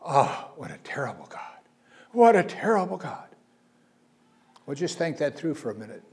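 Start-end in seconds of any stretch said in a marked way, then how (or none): 0.0-3.3 higher mock voice of a complaining skeptic; a hint of a scandalised skeptic; mocking critics. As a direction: parody the scoffers' outrage. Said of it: "you'll hear people say".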